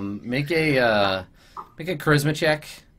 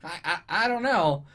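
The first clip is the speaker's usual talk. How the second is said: hesitant goblin lawyer; 7 semitones higher, 3 dB quieter than usual. Acted. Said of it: "he's like".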